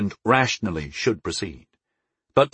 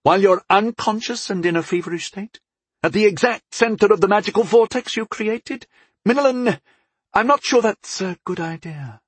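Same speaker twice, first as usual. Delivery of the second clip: high-pitched, incredulous goddess objecting; goddess, alarmed disbelief; 11 semitones higher than usual. Acted.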